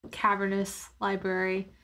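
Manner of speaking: ordinary delivery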